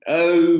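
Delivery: loud, high groaning "oh"; a whole class moaning in dismay. Acted.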